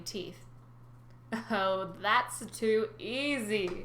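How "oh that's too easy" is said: slow, drawn-out evil purr; gloating old sea god; evil old man. Acted. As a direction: savor it like a cruel trick. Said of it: "he said evilly".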